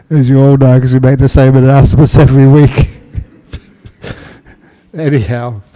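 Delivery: loud, amused, laughing delivery. Real, not acted. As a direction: talk through your own laughter, then settle. Laughing over familiar weekly announcements.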